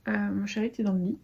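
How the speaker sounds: usual speaking voice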